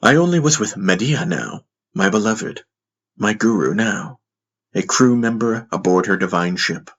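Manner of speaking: usual speaking voice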